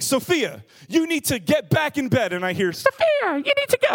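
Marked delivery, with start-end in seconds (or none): 0.0-2.3 a parent's loud, high-pitched scolding; exasperated yell. Retold or imitated. Dad yelling at daughter to get back in bed, exasperated. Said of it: "I yelled at her". 2.7-3.9 very high, loud squeaky parroting; high-pitched toy. Retold or imitated. Toy animal repeating dad's words back, high-pitched mimicry. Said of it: "repeats back what it hears you say in a high-pitched voice".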